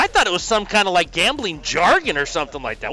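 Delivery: flustered self-defense, pitch leaping on the protest; sheepish realization. Retold or imitated. Reporter retelling his late grasp of the joke, flustered realization. Retold realization after being quizzed.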